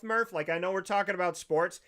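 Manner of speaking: ordinary talk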